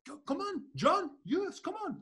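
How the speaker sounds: very high, excited mock driver voice; cracked-out driver hurrying him, in a very high voice; hyped-up driver. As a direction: squeal it like a wired cab driver. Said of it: "And he's like".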